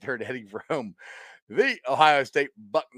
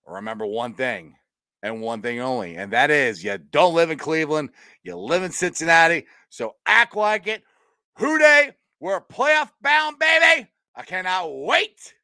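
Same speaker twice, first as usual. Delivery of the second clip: building rallying cry; 2 semitones higher, 4 dB louder than usual. Real, not acted. Host closing show for playoff-bound fans, rising to excited shout. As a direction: build from solemn reminder to full-throated hype.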